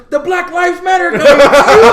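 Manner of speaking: loud high excited call; excited recognition. Retold or imitated. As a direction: blurt it out thrilled to spot him. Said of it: "He goes".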